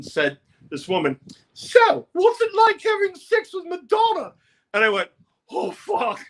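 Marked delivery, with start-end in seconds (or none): 1.5-4.3 much higher, prying female voice; nosy woman reporter. Retold or imitated. Woman reporter grilling the singer, high and prying. 5.5-6.2 dismayed groan. Retold or imitated. Dismayed reaction.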